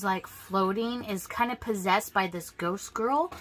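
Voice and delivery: ordinary talk, nothing marked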